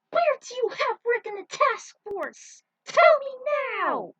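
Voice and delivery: high, loud furious yell; furious demand. Acted. Tinsel demanding Luna reveal Rick's whereabouts, furious yell. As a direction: demand an answer, enraged. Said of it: "as red as a beet".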